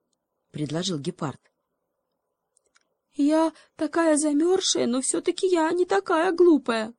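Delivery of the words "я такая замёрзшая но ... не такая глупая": very high, louder squeaky voice; small but stubborn; defiant little monkey. Acted. Monkey refusing the cheetah, high and defiant.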